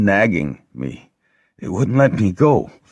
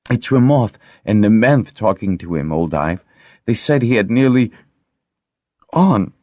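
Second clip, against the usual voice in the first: higher, voice cracking at the end; voice breaking; 4 semitones higher, 4 dB louder than usual. A narrator's reading. Lessa telling Old Ive, voice breaking. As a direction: let the voice break on the last word. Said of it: "Lessa's voice broke on the last note".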